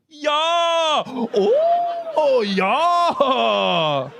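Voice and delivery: loud, high German bedroom cries; drawn-out German-accented wails; mock German accent. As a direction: shout it in ecstasy with a hammy German accent. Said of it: "The Germans probably have one of the worst accents".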